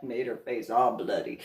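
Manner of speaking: ordinary talk, nothing marked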